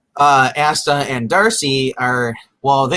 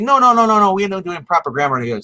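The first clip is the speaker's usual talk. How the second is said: mock objection; 6 semitones higher than usual. Retold interruption correcting her grammar.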